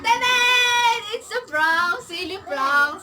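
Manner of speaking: loud, high ta-da reveal; excited reveal. Real, not acted. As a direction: announce the prank with glee. Reveals the prank triumphantly.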